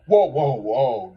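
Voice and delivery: loud, slow, drawn-out alarmed whoa; frightened whoa. Retold or imitated. Narrator startled by the lizard man, loud alarmed whoas. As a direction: back away in alarm. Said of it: "And I was like".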